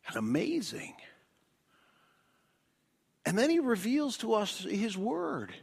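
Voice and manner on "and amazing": softer, whispered; whispered wonder; whispered awe. Acted. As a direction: hush it like a secret marvel. Quoted worshipper's words.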